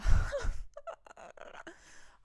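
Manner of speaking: high unvoiced laugh bursting out; burst of laughter. Real, not acted. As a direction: let a loud laugh burst out. Laughing about engagement day.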